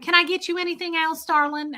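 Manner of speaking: high-pitched, sweet-talking Southern server; Southern sass. Retold or imitated. Server asking a customer if she needs anything else, sassy Southern accent. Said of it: "This is that Southern sass right here".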